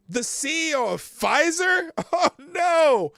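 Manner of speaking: high, loud wailing dismay; mock horror. Host learning it is Pfizer, loud mock horror.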